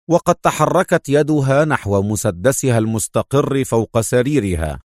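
ordinary delivery

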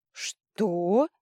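loud, rising, outraged question; roaring. A narrator's reading. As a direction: bellow it in disbelief. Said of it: "взревела Клози". (возмущённый рёв)

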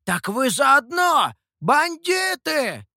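high, loud accusing shout; drawn-out, high-pitched indignant yell; near-squealing old man. A narrator's reading. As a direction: shriek it in outrage like an old man. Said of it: "чуть ли не взвизгнул старик".

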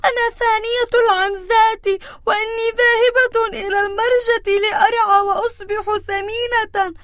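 high, timid little goat; weak voice. Acted. Second goat answering the troll, high and timid. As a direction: answer small and frightened. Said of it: "بصوت ضعيف".

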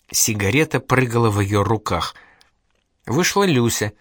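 plain reading voice

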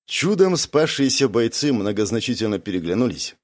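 ordinary delivery, nothing marked

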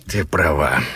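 low, heavy concession; reluctant agreement. A narrator's reading. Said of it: "с трудом согласился я".

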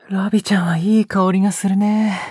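high, savouring coo; blissful delight. Acted. Savours the listener's scent, delighted. (高めの声でうっとり)

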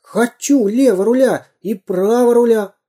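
put-on high, excited childish chant; high eager child voice. A narrator's reading. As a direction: bounce with eagerness. Child eagerly repeats pirate words.

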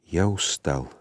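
soft, low confession of weariness; tired hushed voice; quiet, tired. A narrator's reading. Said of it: "сказал он тихо".

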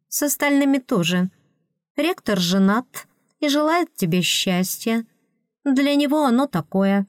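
high, light, calmly reasoning voice; spirit girl voice. Acted.

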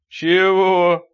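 high, very loud, stretched question; loud challenge. A narrator's reading. Possessed deacon challenges the priest's insult, loud and menacing. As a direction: throw the question out at full voice.

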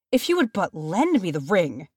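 a hint of desperate persuasion; swooping, insistent plea; pleading warrior. A narrator's reading. Warrior coaxing the hobbit for the ring, pleading.

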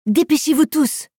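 high-pitched yell without real volume; mild mock yell. A narrator's reading. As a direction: yell it lightly, high and urgent. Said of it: "hurla le chef de la bande".

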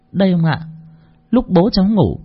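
put-on high, quick child voice; innocent child. A narrator's reading. Little boy telling the shopkeeper about his father, high and innocent. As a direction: chirp it out like an eager little boy.

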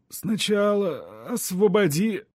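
high, slow wheezing whisper of a hurt man; whistling wheeze. A narrator's reading. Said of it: "свистящим сипом ответил Холост".

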